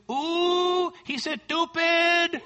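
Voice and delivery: put-on high, drawn-out toddler voice; two-year-old toddler. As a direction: imitate a little kid's scandalised outburst. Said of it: "this little two-year-old toddler".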